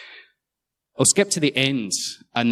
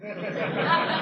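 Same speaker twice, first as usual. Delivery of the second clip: breathy laugh; 8 dB quieter than usual. Real, not acted. Laughing about moving house.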